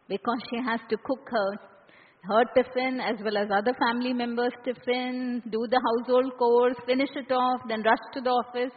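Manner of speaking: usual speaking voice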